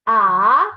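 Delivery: "ah" loud, sliding up from low; second tone. Shown as a demonstration. Teacher reads the four tones, rising "ah". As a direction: swoop "ah" upward and draw it out. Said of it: "read the four tones once more".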